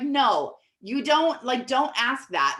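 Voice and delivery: mildly exaggerated mortified refusal; embarrassed insistence. Embarrassed mom overruling the dinner guest, mildly exaggerated insistence. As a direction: insist with a touch of mock embarrassment. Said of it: "instantly embarrassed".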